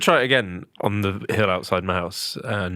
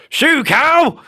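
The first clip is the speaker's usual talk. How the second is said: high, full-volume cow-scaring yell; shout; 8 dB louder than usual. Shown as a demonstration. Teaching friend the trick to cows, demonstrated shout. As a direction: shout it to drive the herd off. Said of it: "lift your arms up and shout".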